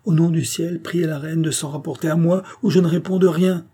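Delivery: urgent, high-pitched appeal; crying out. A narrator's reading. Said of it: "cria Gilbert". (supplication pressante et aiguë)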